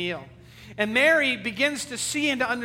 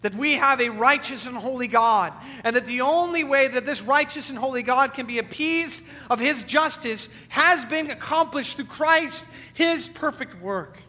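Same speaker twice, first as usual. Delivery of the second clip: loud, raised preaching voice; full-force preaching; 4 semitones higher, 5 dB louder than usual. Acted. Preacher proclaiming the gospel to his congregation, loud and ringing.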